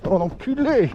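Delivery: high sudden exclamation; excited yell. Real, not acted. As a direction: yelp it out in surprise. Rider drops off short board.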